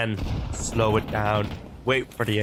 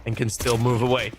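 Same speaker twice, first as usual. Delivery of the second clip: rising, quicker caster call over the action; live play-by-play; 4 semitones higher than usual. Acted. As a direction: call the round as it happens, building up.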